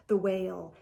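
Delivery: ordinary delivery, nothing marked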